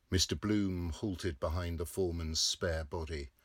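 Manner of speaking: usual speaking voice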